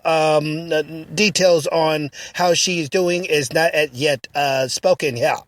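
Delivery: low, measured newsreader tone; news read. Acted. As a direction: read it like a breaking news anchor. Mock news report.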